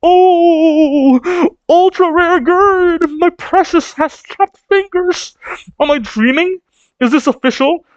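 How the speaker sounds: high, slow, swooning fanboy voice; Roto voice. Retold or imitated. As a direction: gush like an obsessed fan overcome with rapture. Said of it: "this motherfucker Roto going".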